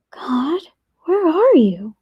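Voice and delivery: high, searching little question; lightly voiced child calling inwardly; whispered thought. A narrator's reading. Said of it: "Nia whispered in her head".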